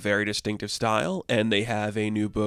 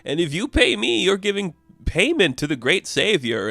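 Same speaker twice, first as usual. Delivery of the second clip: mock sermon pitched high; higher, lilting appeal to a crowd; prayer leader; 8 semitones higher, 3 dB louder than usual. Old soldier soliciting coins from the crowd, prayer-leader delivery. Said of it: "leading a prayer, so to speak".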